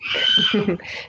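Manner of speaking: short high giggle; a quick high laugh. Real, not acted. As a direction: giggle at the cat. Laughing at the cat.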